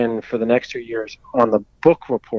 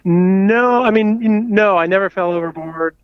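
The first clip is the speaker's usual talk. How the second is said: raised, higher voice over rotor noise; yelling over helicopter; 8 semitones higher, 4 dB louder than usual. Real, not acted. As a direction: call out over a loud helicopter. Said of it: "Sorry about the helicopter".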